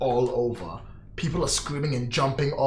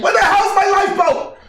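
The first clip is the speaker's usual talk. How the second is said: high, loud panicked yell; panicked shout; 10 dB louder than usual. Acted. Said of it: "it's like".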